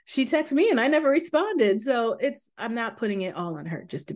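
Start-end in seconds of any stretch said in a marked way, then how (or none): 0.0-1.8 mock shock. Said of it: "I'm like".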